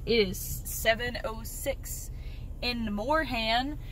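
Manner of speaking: "it is seven oh six in the morning" drawn out slow in a Southern drawl with a rising end. Real, not acted. Telling viewers the time while driving, in a slow Southern drawl. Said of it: "I don't know why I said like that".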